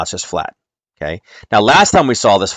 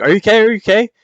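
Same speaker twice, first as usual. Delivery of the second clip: high, loud, hurried checking; retold alarm; 13 semitones higher, 6 dB louder, faster than usual. Parent checking on screaming child at night, mock-panicked and high-pitched. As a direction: act out a startled parent's worry.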